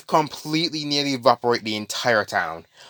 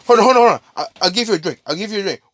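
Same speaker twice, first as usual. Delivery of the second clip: high, loud, hurried pleading; hammed-up frightened plea; mock panic; 8 semitones higher, 4 dB louder, much faster than usual. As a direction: beg high and frantic to stop it. Panicked townsperson begging.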